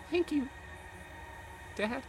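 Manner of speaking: put-on high, small, timid little girl; small child voice. Little Mercy thanks her dad, high and small. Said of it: "in a small, quiet voice".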